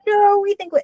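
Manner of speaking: high, jolly mock protest; German officers. German officers on the train disputing the war, high and jovial. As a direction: tease back cheerfully. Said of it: "And they're like".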